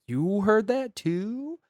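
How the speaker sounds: slow, drawn-out spooked question in a put-on voice; a hint of a scared man, very slow. Steven asks Tristan about the crying, slow and lightly spooked. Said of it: "He's like".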